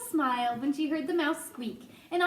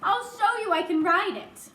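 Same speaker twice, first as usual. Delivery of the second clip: higher, louder, eager plea; pleading; 6 semitones higher, 5 dB louder than usual. A narrator's reading. Mouse offers the boy a demonstration, pleading. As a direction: press the plea with more force. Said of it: "pleaded Ralph".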